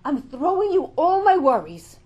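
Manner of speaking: loud, pleading cry to Hashem; impassioned prayer. Acted. Woman preaching, calling out to Hashem in prayer. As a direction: throw your worries up to God, loud and pleading.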